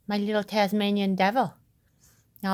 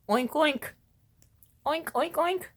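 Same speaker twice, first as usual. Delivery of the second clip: playful mock pig oinks; pig oinking; 7 semitones higher than usual. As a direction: tease with little oinks, rising higher. Imitating a pig to tease puppy.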